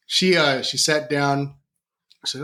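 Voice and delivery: ordinary talk, nothing marked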